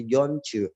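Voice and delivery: DON'T YOU linked as "donchu"; C sound linking. Shown as a demonstration. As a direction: say "don't you" as one word with ch. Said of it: "Tapi ada C-nya di situ". ("don't you" dibaca "donchu")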